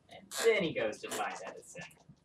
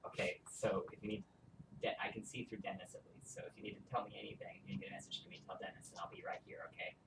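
fast, hushed whisper; 5 semitones lower, 16 dB quieter, faster than usual. Acted. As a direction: rush it out under your breath. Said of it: "Really fast".

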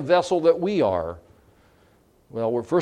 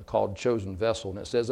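ordinary delivery

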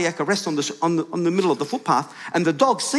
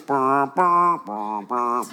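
low lip-buzzed brass notes; mimicking a band with the mouth; imitated band noise; 3 semitones lower, much slower than usual. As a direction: buzz out a slow row of notes. Imitates band heard outside Bunnings.

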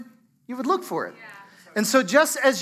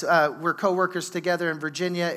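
ordinary delivery

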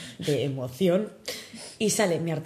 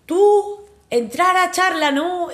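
put-on broken Spanish in a heavy Italian accent, much higher and louder; 10 semitones higher, 7 dB louder, much slower than usual. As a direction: imitate an Italian director's Spanish, high and loud. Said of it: "un español con un acento italiano muy marcado".

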